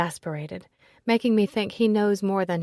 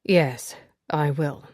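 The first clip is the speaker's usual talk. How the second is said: firm with an edge; edgy; 3 semitones lower, 4 dB louder, slower than usual. Acted. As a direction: answer with a hard edge. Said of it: "distinct edge to his voice".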